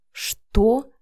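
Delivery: tense, indignant "what?" through gritted teeth. A narrator's reading. Vika reacting to Matvey at his door, teeth-gritted. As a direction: grit it out in disbelief. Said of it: "Вика проскрипела зубами".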